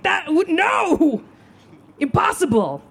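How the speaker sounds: high, drawn-out squeal of disbelief; hammed-up little kid disbelief; shocked denial. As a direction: refuse it outright, pitch shooting up. Child self refusing to believe.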